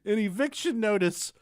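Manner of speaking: high laughing news read; laughing while reading. Real, not acted. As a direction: read the article through laughter. Reads news article laughing.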